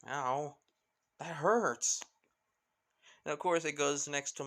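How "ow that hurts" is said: pained yelp. Acted. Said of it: "letting it go and the pain".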